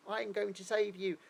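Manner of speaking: high, loud mock-English hero; hammed-up hero with an English accent; parody English accent. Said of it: "he talks like this".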